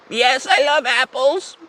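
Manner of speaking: put-on slow, high old lady voice; old lady Janet. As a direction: answer as a high-pitched old woman, drawing it out. Voicing elderly Janet answering prankster.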